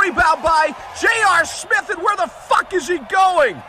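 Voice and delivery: ordinary talk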